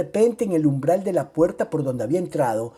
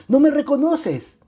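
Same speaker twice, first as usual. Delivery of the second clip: loud, much higher joyful cry; shouting; 12 semitones higher, 8 dB louder than usual. A narrator's reading. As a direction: cry out with open arms. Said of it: "le grité abriendo los brazos".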